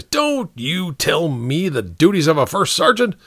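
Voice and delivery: high, irritated captain; snapping. A narrator's reading. Captain resenting advice from his first sergeant, snapping. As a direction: cut the sergeant off sharply. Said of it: "the captain snapped".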